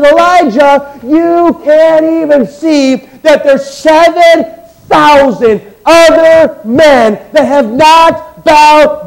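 slow fiery pulpit climax; preaching at full force. Acted. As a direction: drive each phrase home to the church.

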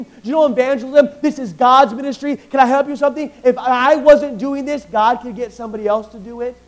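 loud, high, driving pulpit delivery; fiery sermon voice; preaching at full force. Acted. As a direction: preach it out to the whole hall.

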